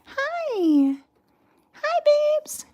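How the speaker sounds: high cooing hello. Real, not acted. Greeting kittens.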